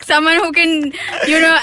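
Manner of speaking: half-laughing, rising to a loud mock-earnest plea; mock breakup speech. Guest retells dumping her boyfriend, mock serious and laughing. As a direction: dump him with fake sincerity. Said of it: "I went up to him and I was like".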